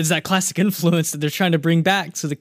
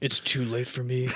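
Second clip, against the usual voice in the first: ordinary talk, nothing marked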